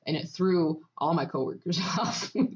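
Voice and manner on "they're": ordinary talk, nothing marked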